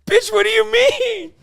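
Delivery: high squeal through laughter; laughing outburst. Real, not acted. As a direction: blurt it out while cracking up. Cracking up at the clip.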